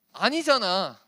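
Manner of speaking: 아니잖아 said loud, long falling 아; 아니잖아 punched loud; emphatic insist. Shown as a demonstration. Insisting to the youth.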